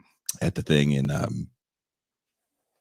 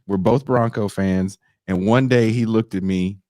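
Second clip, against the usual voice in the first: ordinary delivery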